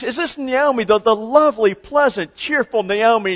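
high, gushing mock delight; delighted friends. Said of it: "her friends are delighted to see her".

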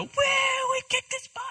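very high, squealed mock celebration; mock cheer. Preacher imagines disciples celebrating the stilled storm, mock cheering. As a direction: whoop it in a silly high falsetto.

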